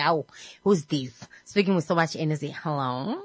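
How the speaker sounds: lightly voiced boss answering a call; imitating the boss. Host imitating her boss answering the phone, low and soft. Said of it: "Because he's like".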